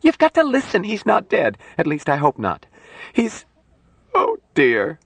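very high, distraught pleading; shrill. Acted. Nurse insists he is not dead, shrilly. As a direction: plead shrilly, faltering at the end. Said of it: "said shrilly".

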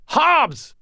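very high-pitched scream; screamed warning. A narrator's reading. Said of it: "I screamed at Michael".

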